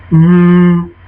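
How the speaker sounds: dim ogre hum; a dull, drawn-out ogre "mm"; simple-minded ogre. Acted. Ogres watching Londo, simple-minded. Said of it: "being played very simple-mindedly and very just".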